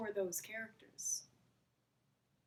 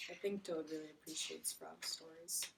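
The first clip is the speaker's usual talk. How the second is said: ordinary delivery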